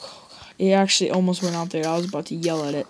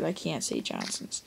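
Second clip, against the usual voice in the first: hushed apology; 4 semitones lower, 12 dB quieter than usual. Pretend. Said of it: "Oh, sorry, sorry".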